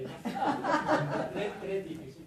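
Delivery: words broken by laughter; giggling through the line; laughing. Real, not acted. Guide cracks up mid-line.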